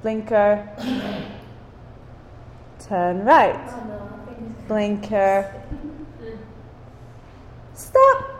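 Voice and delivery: soft, patient driving cues. Retold or imitated. Mom gently talking her daughter through the drive. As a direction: keep it gentle and calm. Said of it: "she said it in a very soft way".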